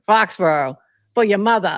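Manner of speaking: put-on loud Ben Affleck-style Boston accent. Podcaster quoting Ben Affleck's silly Boston line, loud Boston accent. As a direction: blurt it in a broad Boston accent. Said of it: "like, Boston, like, stupid thing".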